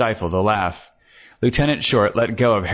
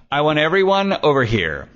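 raised-pitch order to the room; commanding; 7 semitones higher than usual. A narrator's reading. Lieutenant orders everyone to the sofa, commanding. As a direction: take charge, calling everyone over.

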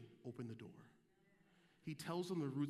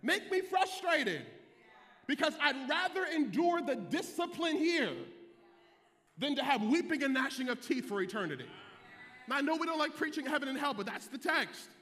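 loud, rising preacher's call; shouting the congregation into the gospel; preaching at full force; 10 semitones higher, 11 dB louder than usual. Acted. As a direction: whoop it up like a Sunday sermon climax.

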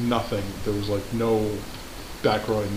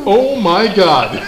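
slow loud amused disbelief; drawn-out laughing oh my God; surprised; 8 semitones higher, 11 dB louder, much slower than usual. Real, not acted.

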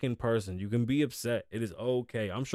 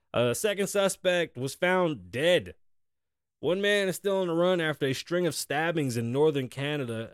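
news read; 4 semitones higher than usual. Acted. Host reading the stabbing report to listeners in a newsy tone. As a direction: read the story like a lightly mock anchor. Reads the article on.

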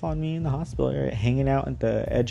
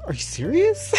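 high, louder disbelieving question then laugh; disbelief; 5 dB louder than usual. Retold or imitated. Asking the clerk in disbelief, high laughing. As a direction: squeal it out then break into laughter.

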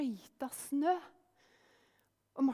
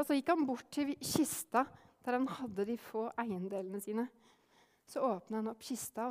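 ordinary delivery

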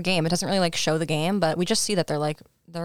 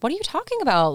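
high-pitched imitation of disbelief; incredulous; 10 semitones higher, faster than usual. Retelling an admirer answering "I'm a has-been", incredulous. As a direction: protest in a high, astonished voice.

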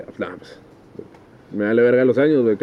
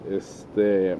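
ordinary delivery

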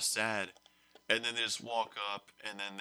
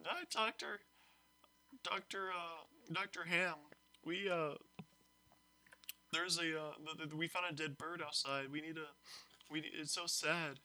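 put-on higher, softer whiny kid voice; mock sad students; 6 semitones higher, 6 dB quieter than usual. Students pleading with teacher over bird, high and soft. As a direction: play the sad students, pitched up and small. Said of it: "And they're like".

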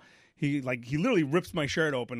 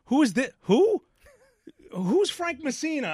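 high, rising bewildered questions; confused staffer; 11 semitones higher, 3 dB louder than usual. Retold or imitated. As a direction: sound thrown by an unexpected call. Hospital staff baffled by call.

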